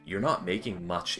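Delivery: ordinary talk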